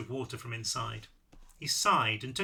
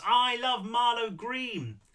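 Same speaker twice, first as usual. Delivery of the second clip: high, drawn-out declaration of love; ecstatic, stretched-out holler; shouted from rooftops; 7 semitones higher, much slower than usual. A narrator's reading. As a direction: call it out long and high, as if from a rooftop. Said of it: "shout it from the rooftops".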